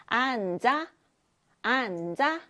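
앉아 read clearly as an-ja; pronunciation demo. Shown as a demonstration. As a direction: sound out 앉아 for the class. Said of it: "앉아는 이렇게 소리가 나요".

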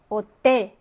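호텔 said slowly with the weight on 텔; stress on the last syllable. Shown as a demonstration. Teacher showing students where hotel is stressed. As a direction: drag 호텔 out and lean on its end. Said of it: "강세 위치 같은 거 찾아보도록".

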